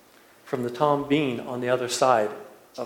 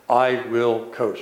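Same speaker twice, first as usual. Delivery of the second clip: deep voice; 2 semitones lower, slower than usual. Retold or imitated. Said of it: "this really deep, deep voice".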